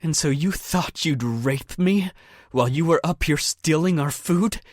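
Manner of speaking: enraged accusation at her attacker; cold rage. A narrator's reading. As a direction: accuse him through rage. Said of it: "She went cold with rage".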